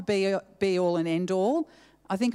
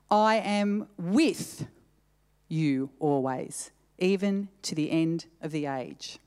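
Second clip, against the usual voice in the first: "with" drawn out and set off by a pause, the rest lower; slow down and land firmly on "with", then pause; stress on WITH; 3 semitones lower, slower than usual. Shown as a demonstration. Preacher rereads the verse for the congregation, stress on "with". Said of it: "put an emphasis on a different word".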